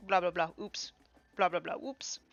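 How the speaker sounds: ordinary talk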